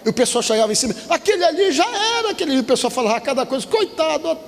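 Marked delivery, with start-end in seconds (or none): 1.1-2.6 mock onlooker's high-pitched cry; alarme de curiosos. Imita o pessoal gritando sobre acidente. 3.7-4.4 high-pitched mock pitying exclamation; pena de curiosos. Imita curiosos com pena.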